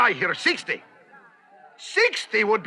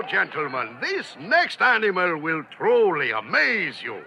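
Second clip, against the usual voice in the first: ordinary talk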